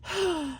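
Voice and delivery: soft, high imitated huff; huffy sigh. Girl huffing beside her in bed, soft mock huffy sigh. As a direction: let out a small put-out sigh. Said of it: "gets a huffing and puffing".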